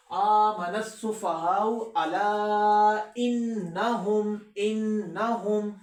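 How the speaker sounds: slow melodic tajweed recitation; Quran recitation. Shown as a demonstration. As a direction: recite slowly and carefully.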